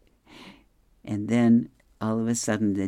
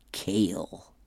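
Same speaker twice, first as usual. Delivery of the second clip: whispered dog line; 4 semitones lower, 5 dB quieter than usual. Acted. Dog's reply in a comic.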